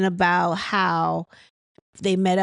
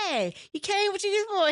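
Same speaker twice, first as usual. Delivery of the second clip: mock star greeting; 12 semitones higher, 3 dB quieter than usual. Quoting Marceau's star entrance.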